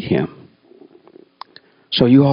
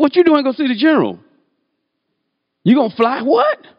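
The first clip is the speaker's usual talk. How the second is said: startled commander; 12 semitones higher than usual. Retold or imitated. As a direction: bark it out in disbelief. Startled commander questions him.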